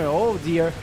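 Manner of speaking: ordinary talk, nothing marked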